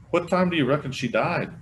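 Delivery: put-on high, loud, anxious old man; nervous old man.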